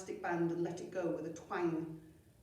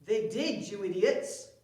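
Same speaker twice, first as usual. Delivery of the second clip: growling chief; 3 semitones higher, 9 dB louder than usual. Acted. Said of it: "growled Chief Cheesy Feet".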